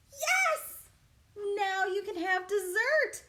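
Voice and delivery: high, loud cheer; cheering parents. Acted. Pea parents cheering their son on, bright and loud. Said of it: "said Mama P and Papa P".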